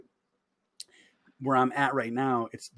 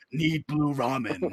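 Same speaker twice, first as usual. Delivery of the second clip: slow, flat robotic craving; zombie-like grunt; much slower than usual. Retold or imitated. Shopper grabbing ramen on autopilot, slow and droning. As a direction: drone it like a mindless shopper. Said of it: "I'm like".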